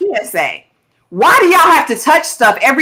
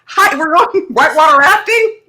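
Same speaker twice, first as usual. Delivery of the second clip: high, mock-astonished squeak; sky-high incredulous question with a laugh; incredulous disbelief; 12 semitones higher, 3 dB louder than usual. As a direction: play up your disbelief at the rafting. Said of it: "'cause I was like".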